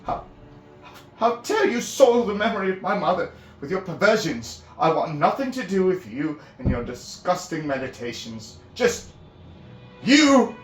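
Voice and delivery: high, full-voiced outraged shouting; furious anger. Finn shouting at priestess over his mother, enraged. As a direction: rage until the words fail you. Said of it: "words clipped with furious anger".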